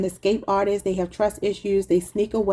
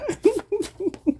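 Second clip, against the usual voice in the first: high, helpless laughter; real laugh at your own joke; cracking up; 12 semitones higher than usual. Real, not acted. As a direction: lose it laughing, high-pitched. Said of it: "I cracked myself up".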